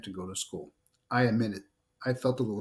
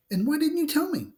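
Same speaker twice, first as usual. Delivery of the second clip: sudden high-pitched disbelief, a bit louder; stunned; 4 dB louder than usual. Acted. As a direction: it bursts out, shocked and hurt. Stunned sister hears hidden news.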